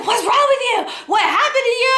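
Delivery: high, urgent alarmed questions; alarmed cousin. Retold or imitated. Cousin at the door to crying arrival, alarmed.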